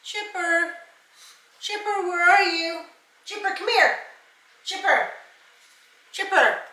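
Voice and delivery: high sing-song call, falling on each name; calling a pet. Real, not acted. Searching the house for the pet Chipper, high calling voice.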